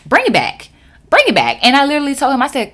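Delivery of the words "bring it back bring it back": louder, sharp insistence; demanding rewind. Retold or imitated. Clerk demanding manager repeat himself, sharp and louder.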